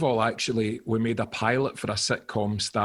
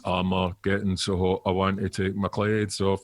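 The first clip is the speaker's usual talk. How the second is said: lower, sulky sung reply; grumpy backing vocals; 5 semitones lower than usual. Retold or imitated. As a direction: answer her line with a bored grump. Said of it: "I would do backing vocals, but really grumpily".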